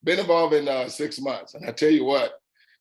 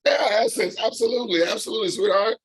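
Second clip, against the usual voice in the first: much higher, glad welcome; delighted greeting; 8 semitones higher than usual. Real, not acted. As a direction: greet her up high with delight. Greets the caller gladly.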